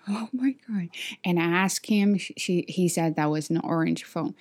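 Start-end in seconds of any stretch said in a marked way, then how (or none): 0.1-0.9 breathless whisper of relief. Retold or imitated. Told a colleague found her orange phone, whispered disbelief. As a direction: whisper it, hardly believing.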